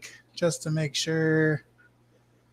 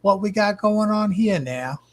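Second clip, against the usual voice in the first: badly faked British accent, pitched up; terrible British accent; 6 semitones higher than usual. Streamer redoing a viewer's comment for fun, terrible British accent. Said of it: "That was a terrible British, British accent".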